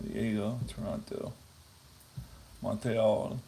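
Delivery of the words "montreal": lightly put-on French accent; a hint of Inspector Clouseau French. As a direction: say it with a playful mock French accent. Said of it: "I did my French accent".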